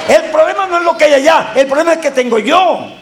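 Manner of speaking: high, sustained preaching shout; full-force preaching. Real, not acted. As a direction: cry it out to stir the congregation. (predicación a voz en cuello)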